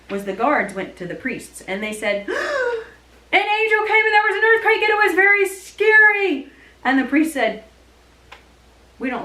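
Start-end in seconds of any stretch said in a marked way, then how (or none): 2.3-2.8 sharp high gasp of fright; mock gasp. Guards tell priests, scared. 3.3-6.5 excited report. Acted. Guards report to priests excitedly.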